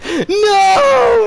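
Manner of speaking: high, loud movie-style cry of no; anguished scream. Retold or imitated. Anakin screaming as Mace is killed, anguished and loud. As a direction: hurl out a desperate no.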